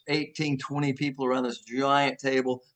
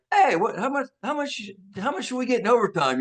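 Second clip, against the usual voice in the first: high-pitched, stumbling question; imitated excited nosiness; retold eager curiosity; 9 semitones higher than usual. Retold eager question about paycheck.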